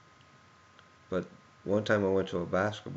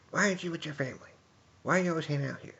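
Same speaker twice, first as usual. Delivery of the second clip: much higher, fast mock-scolding aunt voice; scolding Debbie; 9 semitones higher, much faster than usual. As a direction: rattle it off like a nagging aunt. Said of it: "Debbie, she'd be like".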